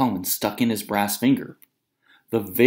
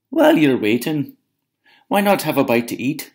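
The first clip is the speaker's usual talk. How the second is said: higher, sugary giant's wife; Una's sweet voice; 6 semitones higher, 5 dB louder than usual. Acted. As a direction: lure him in with honeyed politeness. Una voiced.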